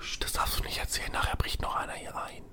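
urgent hushed whisper. Real, not acted. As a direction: whisper so nobody overhears. Hushing so nobody breaks in.

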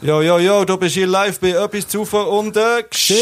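high, loud radio-host greeting; excited. Real, not acted. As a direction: hype up the caller. Greets caller live on air.

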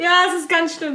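high, giggly complaint; laughing nervously. Real, not acted. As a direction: squeal it out between nervous laughs. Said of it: "Oh, ich schwitze".

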